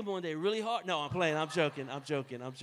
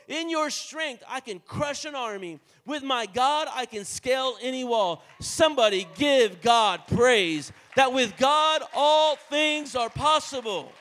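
rising to a full, loud preaching cry; preaching shout; 3 semitones higher, 12 dB louder, slower than usual. Real, not acted. Preacher shouting scripture to the congregation, building loud.

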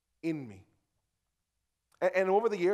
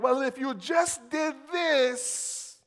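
mock smug husband, higher and slow; smug know-it-all; 9 semitones higher, 6 dB louder, much slower than usual. Husband mocking himself fixing the argument, smug. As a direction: lecture her like you've got all the answers. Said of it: "We'll be like".

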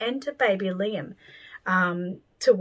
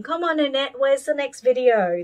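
higher, louder impatient viewer, lightly put on; mock pestering; 6 semitones higher, 5 dB louder than usual. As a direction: imitate someone nagging for more. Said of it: "harassing me and saying".

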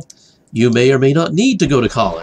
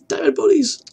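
much higher, delighted; excited squeal. Real, not acted. Gloating over loot.

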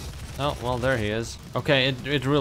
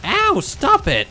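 pained yell; 8 dB louder, much slower than usual. Real, not acted. Gets hit mid sentence.

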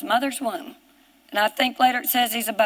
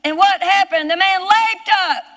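high, impassioned pulpit cry; full-force preaching; 6 semitones higher, 3 dB louder than usual. Real, not acted. Preacher marvelling to congregation that the lame man leaped, impassioned shout. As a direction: proclaim it in long, hammered phrases.

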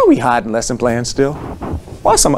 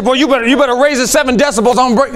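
mock threatening teacher; 8 semitones higher, faster than usual. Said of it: "I didn't say".

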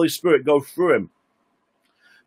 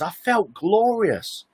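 high, slow and awed, rapturous; high, slow, rapturous quote; glorious joy; 6 semitones higher, slower than usual. Retold or imitated. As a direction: lift it up high and drawn out, overwhelmed with joy. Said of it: "Charles gets out of bed and he says".

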